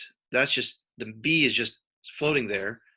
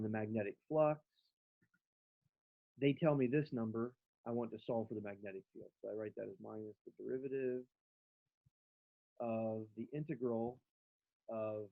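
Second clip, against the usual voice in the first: distant, muffled, like in a tunnel; speaking far off a bad mic; 10 dB quieter than usual. Real, not acted. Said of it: "your mic is sounding like you're in a tunnel".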